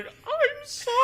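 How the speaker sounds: very high, squeaky mock sorry; mock apology. Recruit apologizing to friends outside, squeaky high voice. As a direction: pipe it up high like a guilty cartoon. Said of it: "just going".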